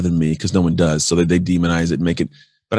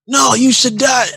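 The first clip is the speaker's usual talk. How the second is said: slow, much higher, louder shriek of frustration; exasperated mock yell; 4 dB louder, slower than usual. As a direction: wail it out like a heckler losing it. Heckler's final outburst at Gandhi.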